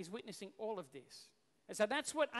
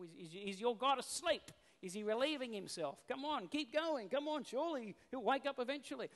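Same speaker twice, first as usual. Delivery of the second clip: mock encouragement, rising higher; 4 semitones higher than usual. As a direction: jeer at rivals who are failing. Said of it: "Elijah's even mocking them".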